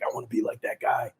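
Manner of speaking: hushed, breathy aside to himself; envious whisper; whispered wish. Retold or imitated.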